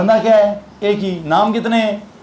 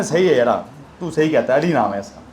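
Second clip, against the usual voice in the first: father's voice, pitched lower and a little softer; a lowered, easygoing dad giving in; imitating the father; 8 semitones lower, 3 dB quieter than usual. Teacher voicing the father agreeing to the nickname, low and relaxed. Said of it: "अब्बा भी एक दिन क्या कहते हैं".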